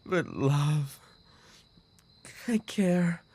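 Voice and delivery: high, broken and tearful; despairing sobbing. Acted. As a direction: plead with yourself through tears. Despairing self-blame after loss.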